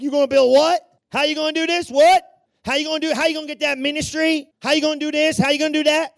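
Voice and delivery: high, loud jeering questions; mocking disbelief. Scoffers laugh at him over the little wall, mocking incredulously. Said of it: "they're laughing at him like".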